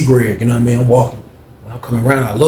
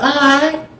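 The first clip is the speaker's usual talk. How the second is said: much higher, sulky sign-off; Greg's high sulky voice; 13 semitones higher than usual. Retold or imitated. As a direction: pout it high. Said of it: "He was like".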